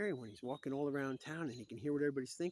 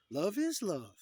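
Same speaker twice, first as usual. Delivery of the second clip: high, loud, gushing chant; exaggerated, high-pitched cheer; mock cheering slogan; 8 semitones higher, 10 dB louder, slower than usual. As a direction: belt it out as an over-the-top slogan. Mock slogan, repeated with gusto.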